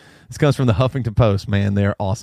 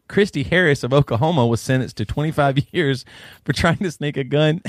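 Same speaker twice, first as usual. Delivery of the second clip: ordinary talk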